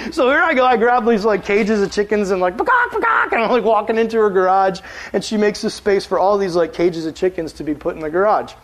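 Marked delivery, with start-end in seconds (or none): 2.6-3.3 high clucky chicken call; high playful chicken imitation; chicken noises. Imitating chickens while carrying them.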